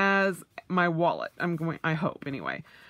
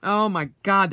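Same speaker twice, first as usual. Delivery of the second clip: usual speaking voice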